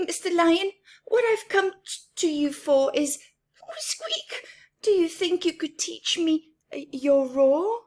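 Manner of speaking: nervous little mouse pleading; squeaky mouse voice. Acted. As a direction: play the shy mouse, voice lifted high. Mouse asks Lion to teach roar.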